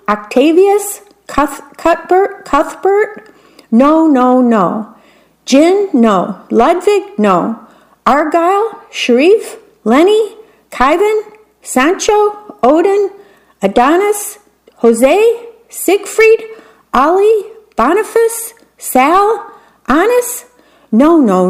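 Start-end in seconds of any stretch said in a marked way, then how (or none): 0.1-3.3 queen reading names. Acted. Queen reads names from list. 8.0-20.4 queen voiced much higher, rattling off names hopefully; hopeful queen reading guesses, pitched up high; queen reading her list. Queen reads long name list.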